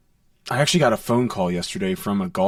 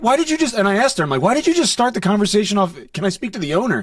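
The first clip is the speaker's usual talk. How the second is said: high-pitched, incredulous questioning; retold exasperation; 10 semitones higher, 4 dB louder than usual. Worker retelling how he questioned the caller, exasperated. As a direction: challenge the caller in mock exasperation.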